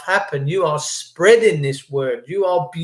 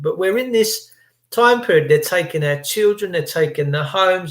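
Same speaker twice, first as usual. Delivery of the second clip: usual speaking voice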